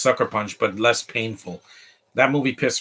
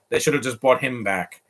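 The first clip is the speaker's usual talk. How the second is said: ordinary talk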